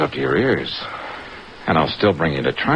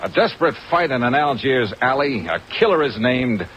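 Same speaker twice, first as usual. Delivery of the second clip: radio teaser read; 4 semitones higher than usual. Acted. Next-episode teaser in character.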